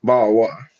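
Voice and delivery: British bottle of water with dropped T's; mock British accent. Shown as a demonstration. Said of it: "In British".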